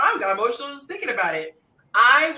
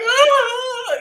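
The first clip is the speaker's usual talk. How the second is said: sharp high wince of pain; high pained cry; 7 dB louder than usual. Retold or imitated. Mimicking a painful pinch with a high yelp. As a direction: yelp as if pinching her own finger.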